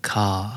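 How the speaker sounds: CAR with the final R silent; British-style CAR, no r-sound. Shown as a demonstration. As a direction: end CAR on an open vowel. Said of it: "แต่ในขณะที่อังกฤษจะ".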